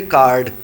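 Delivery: کارڈ with plain K, no puff of air; correct, unaccented pronunciation. Shown as a demonstration. Teacher showing pronunciation without accent, plain K. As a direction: say کارڈ cleanly with an unaspirated K. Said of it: "اس میں accent تو نہیں آیا، pronunciation آ گئی".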